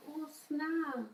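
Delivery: very soft, understated reaction in a retold scene; calm. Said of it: "and I'm so calm".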